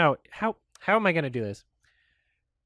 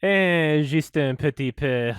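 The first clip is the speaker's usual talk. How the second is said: French accent; 5 semitones lower, slower than usual. Shown as a demonstration. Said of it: "in a French accent".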